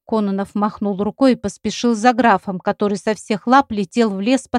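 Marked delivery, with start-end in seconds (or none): none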